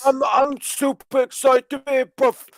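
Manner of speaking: high-pitched, slurred British wrestler parody; mush-mouthed wrestler. Acted. New signing greets the crowd, mush-mouthed and high. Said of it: "sounds like he's been punched in the fucking mouth".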